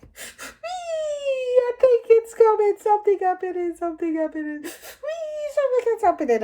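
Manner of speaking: very high, loud screaming wail. Retold or imitated. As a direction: shriek that the baby is coming. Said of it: "I kept screaming".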